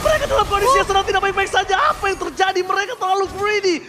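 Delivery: loud, rising esports casting; live play-by-play. Real, not acted. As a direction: build the tension of the fight.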